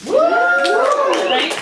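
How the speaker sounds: high, loud whooping cheer; excited whoop. Real, not acted. Author whooping to the crowd about the amazing bookstore, loud and high.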